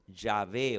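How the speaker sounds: "Yahvé" con "sh" inicial y "b" suave; pronunciación de Yahvé. Shown as a demonstration. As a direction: di "Yahvé" como "sha-BÉ", despacio. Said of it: "Se pronuncia". ("Yahvé" pronunciado lento, "ya-BÉ" rioplatense)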